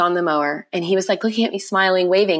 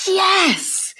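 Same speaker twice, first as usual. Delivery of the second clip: joyful cry; 13 semitones higher than usual. Retold or imitated. Mother seeing her baby breathe again, joyful high yes. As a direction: let Yes fly up in sheer relief.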